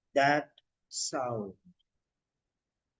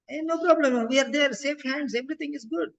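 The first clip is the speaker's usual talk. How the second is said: much higher, softening reassurance; reassuring; 8 semitones higher, 3 dB quieter than usual. Shown as a demonstration. Teacher models soothing a worried patient, much higher. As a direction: soothe them so they feel safe. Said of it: "So reassurance".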